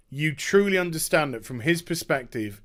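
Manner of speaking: ordinary talk, nothing marked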